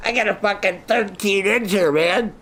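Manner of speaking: high, mocking brag voice; mock bragger. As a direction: ham up a cocky boast.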